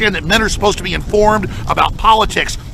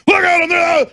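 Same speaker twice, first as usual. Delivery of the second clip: high shouted call to the crowd; rallying call; 10 semitones higher than usual. Retold or imitated. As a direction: shout at folks to rally, much higher. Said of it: "I go".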